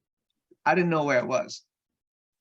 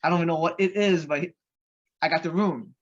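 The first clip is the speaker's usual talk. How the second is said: excited; 4 semitones higher, 3 dB louder than usual. Retold or imitated. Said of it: "it was just exciting".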